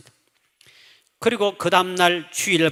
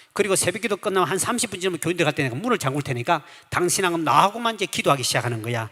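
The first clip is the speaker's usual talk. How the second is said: usual speaking voice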